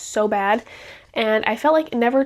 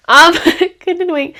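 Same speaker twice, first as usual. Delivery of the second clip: laughing; 7 semitones higher, 10 dB louder than usual. Real, not acted. Laughing after math mistake.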